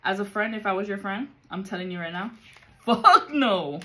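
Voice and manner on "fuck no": loud, firm refusal; emphatic loud no. Real, not acted. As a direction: shut it down hard.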